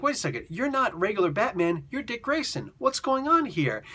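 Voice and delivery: surprised. Retold or imitated. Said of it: "He's like".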